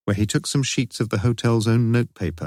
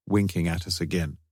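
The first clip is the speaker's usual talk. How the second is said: usual speaking voice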